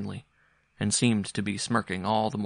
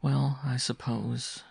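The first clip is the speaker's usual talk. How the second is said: higher, drawn-out reluctant answer; slowly and bitterly; 4 semitones higher, much slower than usual. A narrator's reading. Mother explains poverty to son, slowly and bitterly. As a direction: stretch it out slowly with some bitterness. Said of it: "she said slowly and bitterly".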